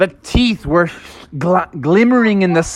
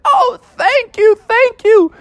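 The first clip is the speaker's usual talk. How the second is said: very high, gushing thanks; rescued frog girl; 3 dB louder, slower than usual. Acted. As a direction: squeal it with acted relief. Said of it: "She's like".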